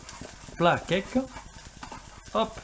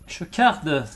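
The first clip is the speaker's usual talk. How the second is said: put-on joking German accent, a touch louder; lightly hammed German accent; mock German accent; 2 semitones higher, 6 dB louder than usual. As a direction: say it like a playful German. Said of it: "J'ai pris l'accent allemand".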